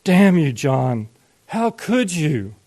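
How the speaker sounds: slow, forceful condemnation; hateful, condemning. A narrator's reading. Father halfway up the stairs damns his son, hateful. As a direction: condemn him with slow, heavy hatred. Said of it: "In a hateful, condemning voice".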